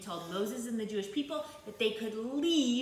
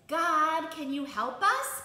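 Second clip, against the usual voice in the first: higher, raised-voice acted plea; pleading call; 5 semitones higher, 4 dB louder, slower than usual. The people ask God for help, pleading call. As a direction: call out for help, lifting at the end.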